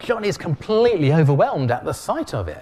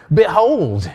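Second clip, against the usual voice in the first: loud, raised proclamation; big loud voice; 5 semitones higher, 8 dB louder than usual. Acted. Preacher voicing Jesus to sleepy Christians, big loud voice. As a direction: announce it big, high and loud. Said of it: "in a big loud voice".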